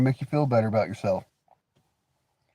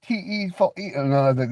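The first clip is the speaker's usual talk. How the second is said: usual speaking voice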